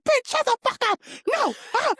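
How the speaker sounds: high-pitched mock screaming outburst; imitated scream. Zach going off on Brittany from another room, imitated screaming. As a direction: shriek it, imitating an enraged man's yell. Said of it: "then he screams at Brittany".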